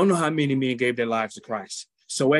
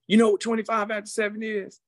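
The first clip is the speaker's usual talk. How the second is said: high, teasing setup of a joke; a host's playful riddle; joking; 9 semitones higher than usual. Retold or imitated. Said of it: "So he made jokes".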